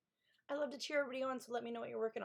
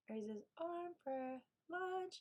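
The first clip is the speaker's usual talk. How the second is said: higher, slow, softer drawl; playful teasing; 6 semitones higher, 6 dB quieter, much slower than usual. Real, not acted. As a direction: tease the viewer fondly. Reading chat message playfully.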